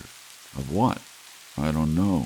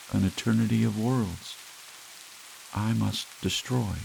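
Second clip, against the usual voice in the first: halting, dreamy voice, long pauses between phrases; eerie young killer; 4 semitones higher than usual. Acted. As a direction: drift through it like a sleepwalker, detached. The kid speaks, halting.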